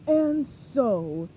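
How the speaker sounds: loud gruff pirate; hammy pirate captain. Acted. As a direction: boom it like a storybook pirate. Burger Beard voiced in story.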